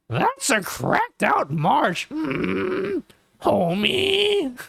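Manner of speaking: slow, high put-on voice of a pair of chain-smoking sisters; Patty and Selma. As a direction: drag the words out in a cartoon sister voice. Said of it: "Patty and Selma".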